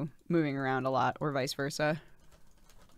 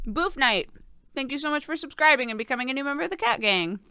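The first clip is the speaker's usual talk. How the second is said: excited; 9 semitones higher, 4 dB louder than usual. Real, not acted. Fast thanks to new member.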